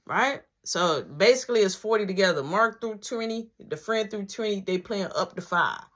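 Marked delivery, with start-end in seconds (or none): none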